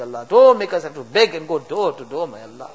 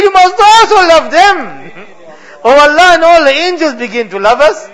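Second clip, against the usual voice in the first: higher and louder, crying out to God; fervent loud pleading; 8 semitones higher, 5 dB louder than usual. Real, not acted. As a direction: let the plea swell into a passionate cry. Climax of dua, impassioned crying out.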